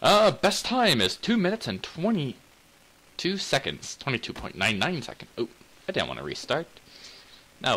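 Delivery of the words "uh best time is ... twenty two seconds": normal talking voice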